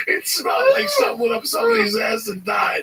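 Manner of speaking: high, loud disgusted complaint; high-pitched loud disgust; disgusted junkie. Retold or imitated. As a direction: shout it up high, grossed out. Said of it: "he goes".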